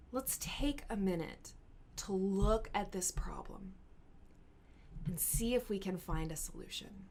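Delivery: calm everyone down in an argument; calm mediator. Acted. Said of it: "let's talk about them calmly".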